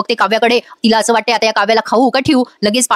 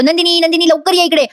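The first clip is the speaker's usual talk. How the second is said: excited calling; 6 semitones higher than usual. Retold or imitated. Jeeva calling with happy news.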